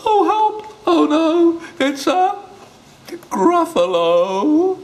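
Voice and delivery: high, loud terrified cry; panicked squeal. Acted. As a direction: shriek it out in panic. Mouse panics at the Gruffalo.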